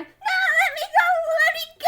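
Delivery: hammed-up, high-pitched yell; panicked pleading. Captive ginger yelling to be let go, loud high panic. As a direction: squeal like a tiny struggling captive.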